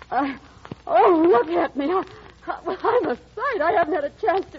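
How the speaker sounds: flustered. Acted. Flustered at unexpected visitor.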